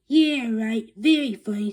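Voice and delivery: loud sarcastic retort. Acted. Principal mocking the teacher, loud sarcasm. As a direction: throw it back, loud and sneering.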